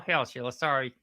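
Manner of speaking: ordinary talk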